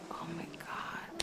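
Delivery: breathless, barely voiced whisper; words catch as the feeling hits her; overwhelmed hush, almost no voice; choked whisper. Real, not acted. Overcome remembering the dream.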